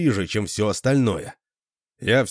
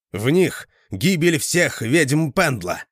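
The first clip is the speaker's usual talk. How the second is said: prophetic trance voice; 6 semitones higher, 3 dB louder, slower than usual. A narrator's reading. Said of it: "будто по велению незримой силы".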